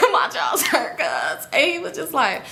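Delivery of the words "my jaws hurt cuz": mock whiny excuse. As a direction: squeal it slowly, laughing at yourself. Mocks her own excuse, laughing.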